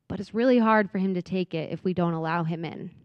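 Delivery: ordinary talk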